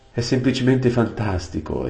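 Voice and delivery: high, delighted cry of wonder; exclaiming in amazement; excited exclamation. Acted. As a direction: gasp it out in awe. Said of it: "esclamò Aravis".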